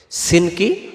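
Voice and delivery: normal talking voice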